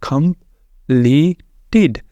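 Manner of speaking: कंप्लीटेड stretched out slowly, every syllable separate. Shown as a demonstration. Teacher sounds out the word slowly for students. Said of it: "कैसे बना है".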